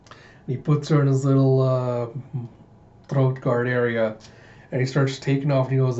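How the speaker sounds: ordinary talk, nothing marked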